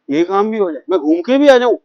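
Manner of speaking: ordinary talk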